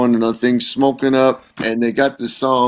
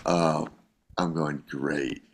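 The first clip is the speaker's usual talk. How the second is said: low, soft, resigned; quiet, dejected letdown; deflated; 6 semitones lower, 11 dB quieter, slower than usual. Retold or imitated. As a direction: let the disappointment sink your voice. Retold dejection at being called in.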